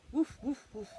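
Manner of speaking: loud playful woof woof; barking dog. Pretend. Reading to a child, dog barks loudly. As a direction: bark it out like a happy dog. Dog noise in bedtime book.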